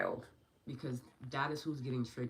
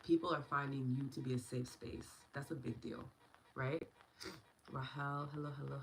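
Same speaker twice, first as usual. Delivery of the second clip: ordinary talk, nothing marked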